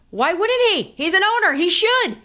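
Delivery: high, loud and insistent; emphatic rhetorical outburst. Real, not acted. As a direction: pitch it up high, arguing the obvious. Emphatic rhetorical question about owner.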